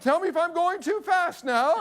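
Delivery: put-on high-pitched old lady giving a ride; older woman. Older woman to boy in her basket, high-pitched voice.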